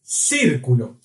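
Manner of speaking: "Círculo" starting with an S sound; C as double S. Shown as a demonstration. Teacher giving an example of C before I, S sound. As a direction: say the first C of "Círculo" as S. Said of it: "tem o som de dois S's".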